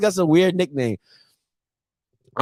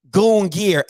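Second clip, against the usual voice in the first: ordinary talk, nothing marked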